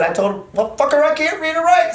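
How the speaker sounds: high, fast indignant protest; imitating the cousin. Cousin retelling his punishment, a high indignant protest. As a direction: squeak out the outrage. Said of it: "he did just like this".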